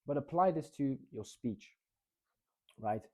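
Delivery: ordinary delivery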